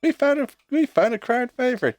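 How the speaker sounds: mocking repeat. Mocking the panelist line.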